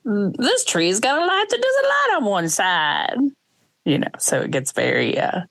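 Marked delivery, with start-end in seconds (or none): 0.0-3.2 high, slow smarmy Grinch; a smarmy old storybook villain. Acted. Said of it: "my version of the Grinch's voice".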